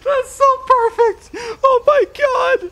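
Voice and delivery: high-pitched laughing shriek; hysterical laughing. Real, not acted. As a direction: laugh till you can't breathe.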